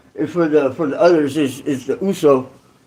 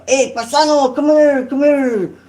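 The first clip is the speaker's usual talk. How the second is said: higher, insistent come-here call; imitating a Mexican inmate calling out, pitched up; beckoning call; 7 semitones higher than usual. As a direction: wave him over like a big yard guy. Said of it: "the biceps would be".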